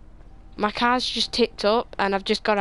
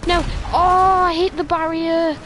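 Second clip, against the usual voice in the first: much higher, loud outcry; real dismayed yell; 8 semitones higher, 8 dB louder than usual. Real, not acted. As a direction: blurt it out as the crash happens. Crash into barrier.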